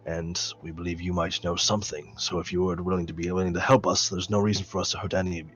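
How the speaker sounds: low, calm, measured; calm character voice. Acted. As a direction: speak softly and steadily.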